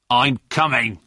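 mild shout from behind a door; understated call to a caller outside; shouted answer. A narrator's reading. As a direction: call out that you're on the way. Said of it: "shouted a voice".

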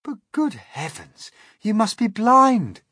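put-on high, loud boy voice; excited young boy; James voice. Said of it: "said James".

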